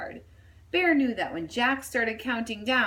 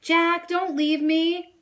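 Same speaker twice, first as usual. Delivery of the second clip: high, slow, desperate toy cry; bunny calling after his boy; frightened yell; 8 semitones higher, 5 dB louder, slower than usual. A narrator's reading. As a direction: plead not to be left behind. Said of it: "Bear yelled".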